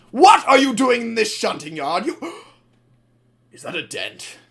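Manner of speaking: the manager scolding in the shunting yard, mock angry. Manager catching them in the yard, angry then aghast. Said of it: "he's like".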